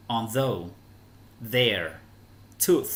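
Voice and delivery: isolated words, falling, with clear "th" sounds; th pronunciation demo. Shown as a demonstration. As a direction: say each word separately, stressing the th. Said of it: "Although là âm the".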